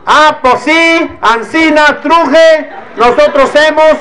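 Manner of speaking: high, put-on rustic Mexican country speech; mock an unschooled rancher mother's rustic Mexican talk. Mother talking with her teen daughter, mocking high rustic Mexican voice.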